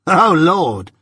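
bored lout scoffing at Pip; scornful scoff. A narrator's reading. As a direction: sneer out a mock exclamation. Drummle mocks with a scoff.

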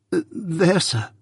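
put-on a shy child explaining softly; timid. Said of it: "I timidly explained".

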